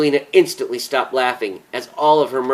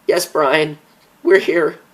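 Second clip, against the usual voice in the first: crying while speaking; 5 semitones higher, 4 dB louder, slower than usual. Acted. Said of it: "Ramona was crying as she spoke".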